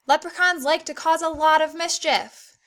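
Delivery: teacher modelling an exclamation with excitement; excited. Shown as a demonstration. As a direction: read it like the mischief is thrilling. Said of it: "because it's pretty exciting".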